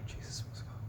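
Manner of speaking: whispered under his breath, annoyed; exasperated whisper. Real, not acted. Trying to recall a name, he whispers to himself exasperated. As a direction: mutter it to yourself in a sigh.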